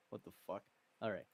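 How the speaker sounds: ordinary delivery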